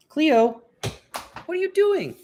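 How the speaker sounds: high, singsong call-out; calling the cat. Real, not acted. Owner calling Cleo the cat, high singsong call. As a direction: call out loud, then swoop up high.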